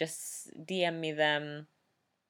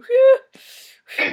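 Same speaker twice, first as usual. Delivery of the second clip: high loud dove coo; cooing sound; 18 dB louder than usual. Shown as a demonstration. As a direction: coo like a pigeon. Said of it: "I can do a coo".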